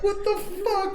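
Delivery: freaked-out squeal; shocked yelp. Retold or imitated. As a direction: squeal it in disgust. Earwigs fall on him.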